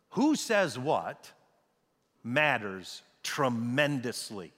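"Who says what matters tremendously" spaced out slowly with pauses; slow right down, weigh "matters" and "tremendously"; slow emphatic. Real, not acted. Preacher restates his point after the survivor's story, slow and emphatic on "matters tremendously".

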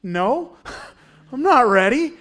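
high, loud comic protest; playfully startled, pitch jumping up; mock alarmed refusal. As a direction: balk with a yelped, hammed-up refusal. Imagined panicked refusal to preach.

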